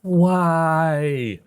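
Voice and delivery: long, high, falling cry of "why"; drawn-out cry of despair, hammed up; mock anguished wail. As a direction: wail it out in exasperation. Exasperated cry at the cursor problem.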